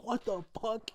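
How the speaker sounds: loud, high mock shriek of disbelief; shocked outburst. Girl reacts to monstrous tongue.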